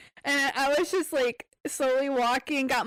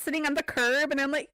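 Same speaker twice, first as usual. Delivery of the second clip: ordinary talk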